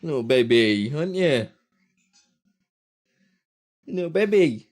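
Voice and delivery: cooing at his son after nursery; cooing baby talk. Retold or imitated. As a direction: coo it to a little one. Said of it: "I was just like".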